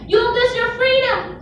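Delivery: high, loud honk of a goose. A narrator's reading. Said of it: "honked the goose".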